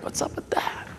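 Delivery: breathy whispered gripe; frustrated whisper. Retold or imitated. Frustrated dad whispering at the pool rule. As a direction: hiss it under your breath as a joke. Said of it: "I'm like".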